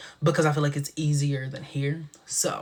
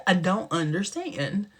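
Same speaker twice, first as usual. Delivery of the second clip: normal talking voice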